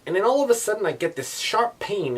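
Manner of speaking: high, loud burst of alarm; startled retelling. Retold or imitated. Driver retells the sting, suddenly alarmed. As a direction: hit the sudden moment hard.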